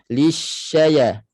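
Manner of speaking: LISHAYA with a pure EE in li; true li vowel. Shown as a demonstration. Teacher models word for students, clear li. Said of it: "Betul-betul bunyi li".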